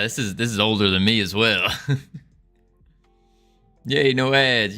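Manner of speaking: ordinary talk, nothing marked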